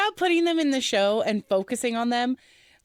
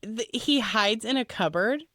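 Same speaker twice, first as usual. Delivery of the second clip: usual speaking voice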